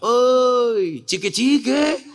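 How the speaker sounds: high, drawn-out hotel stranger's call; mock-proud call. Preacher imitates the man at the hotel, mock-proud call. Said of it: "Dia bilang dengan bangganya".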